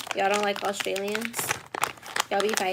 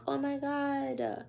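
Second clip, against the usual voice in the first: higher, soft, drawn-out swoon; playful imagined swoon, slow and high; mock swooning; 4 semitones higher, 5 dB quieter, much slower than usual. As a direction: stretch it out softly, pretending to melt over an accent. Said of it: "then I'd be like".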